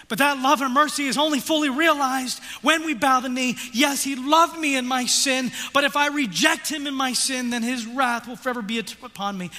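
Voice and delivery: loud, high, driving sermon voice; fiery preaching. Acted. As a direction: press the guilt home from the pulpit. Preaching at full force.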